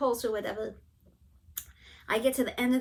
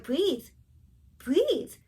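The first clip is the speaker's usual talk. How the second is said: raised, insistent retold plea; urgent command; 6 semitones higher, 6 dB louder than usual. Wife reenacting orders to her coughing husband, urgent raised voice. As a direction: call it out higher and louder, one push per word.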